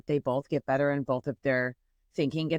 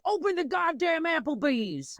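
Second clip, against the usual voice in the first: high, slow ranting voice; imitated ranting guy; 10 semitones higher than usual. Imitating the angry guy demanding Applebee's open, high and slow. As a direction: play the serious funny guy yelling. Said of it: "he's like".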